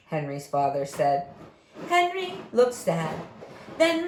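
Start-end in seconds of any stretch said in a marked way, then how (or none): none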